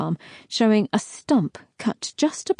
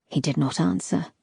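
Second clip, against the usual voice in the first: ordinary delivery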